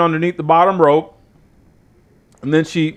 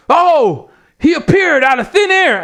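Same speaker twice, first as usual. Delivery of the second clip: high, over-excited mock announcer; gasp-and-marvel commentary, pitched way up; Excalibur; 11 semitones higher than usual. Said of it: "Excalibur goes".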